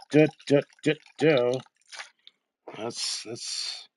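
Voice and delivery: ordinary talk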